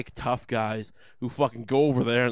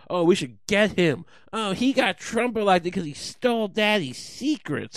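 higher, louder, slow mocking whine; mock whining; 7 semitones higher, 5 dB louder than usual. Comic mocking loyalists, slow whiny voice. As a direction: whine it like a crybaby. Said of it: "start whining about it and go".

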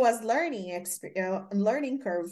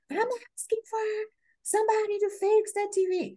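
mock scream; 13 semitones higher than usual. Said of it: "she screamed at my colleague".

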